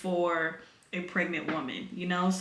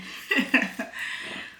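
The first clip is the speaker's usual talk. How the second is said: breathy laugh; 3 semitones higher than usual. Real, not acted. Laughs about being upset.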